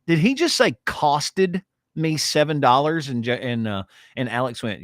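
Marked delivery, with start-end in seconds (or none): 0.1-3.1 incredulous. Retold or imitated. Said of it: "And I just went".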